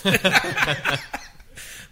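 breathy burst of laughter; laughing. Real, not acted. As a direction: laugh it off hard. Laughs at his wrong prediction.

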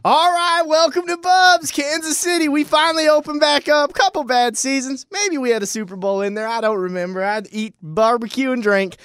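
high, loud bar host shout; announcer voice. Acted. Introducing bar character on show.

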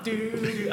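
high, softly voiced "doo" notes; sung-out riff syllables. Bandmate countering with another riff idea, high vocalised notes. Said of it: "And I'm like".

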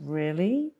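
incredulous, questioning coach; challenging coach. Acted. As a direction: doubt every word they claim. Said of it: "a great coach will challenge them".

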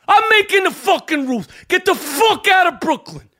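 put-on angry old Brooklyn pizza man yelling; furious pizzeria owner shouting. Sal character rant.

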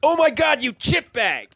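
high shouted seminar rant; angry guru. Acted. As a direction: blow up at an attendee. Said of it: "he should get mad. He's like".

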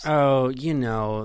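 ordinary talk, nothing marked

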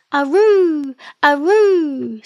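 high, drawn-out exclamation, swooping down; excited kiwi chorus. A narrator's reading. Kiwis thrilled by the news.

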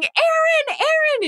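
very high-pitched fan squeal of a name; excited screaming. Retold or imitated. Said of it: "She was screaming".

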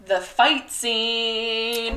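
very slow, savored phrase; drawn out. Real, not acted. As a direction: drag out the fight scene. Dramatic build-up.

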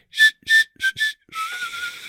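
drawn-out string of shushes; shushing. Shown as a demonstration. Co-host shushing whoever makes a bad argument, shushing. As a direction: hush them with repeated shh sounds. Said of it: "I'm just gonna go".

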